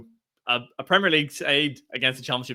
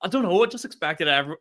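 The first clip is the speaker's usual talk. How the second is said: ordinary talk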